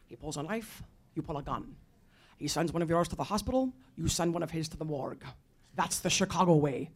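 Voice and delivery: low-pitched Scottish accent impression; Sean Connery. Lecturer quoting the Irish sergeant's advice, Scottish accent. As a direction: do the famous movie line with a Scottish burr. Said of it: "in this Sean Connery Scottish accent".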